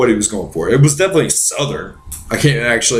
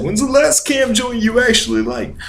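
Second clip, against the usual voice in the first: higher quoted jab; 12 semitones higher than usual. Retold or imitated. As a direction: toss off fifty's line a touch higher. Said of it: "It's like when fifty said".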